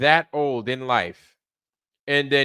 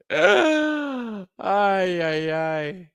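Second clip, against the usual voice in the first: pained high sigh; 6 semitones higher, much slower than usual. Real, not acted. Pained reaction to flat earther.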